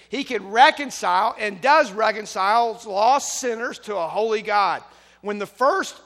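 loud, emphatic pulpit proclamation; fervent preaching. Acted. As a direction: drive it home like a preacher at full force. Preaching at full force.

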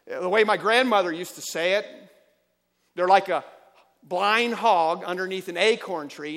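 loud, rising sermon delivery, driving each point home; preaching at full force. Acted. Pastor to congregation, preaching at full force.

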